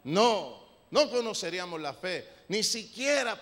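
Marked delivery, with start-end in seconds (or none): none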